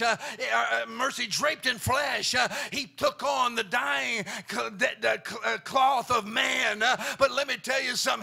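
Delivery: loud rhythmic whooping preacher; full-force gospel whoop; preaching at full force. Acted. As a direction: ring it out to the congregation with a gasped uh.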